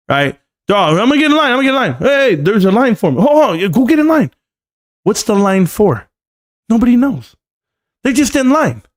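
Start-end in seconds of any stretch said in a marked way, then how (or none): 0.7-4.3 high, excited herd chatter; mock eager crowd. Streamer acting out the crowd running to line up, mock eagerness. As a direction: imitate people rushing to join in. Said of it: "you see everybody running to get in line".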